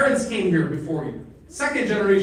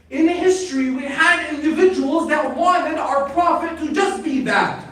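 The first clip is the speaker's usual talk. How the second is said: raised pulpit voice; full-force preaching; 8 semitones higher, 7 dB louder than usual. Real, not acted. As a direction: preach it hard and insistent, pitched high.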